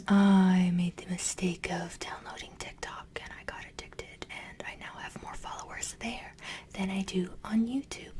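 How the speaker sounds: soft whispered aside; whispering. Real, not acted. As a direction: whisper it close to the mic. Whispered intro.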